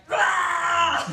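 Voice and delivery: loud victory roar; triumphant scream. Pretend. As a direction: roar it out over the corpse. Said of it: "screaming at it".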